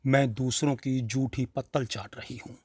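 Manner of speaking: plain reading voice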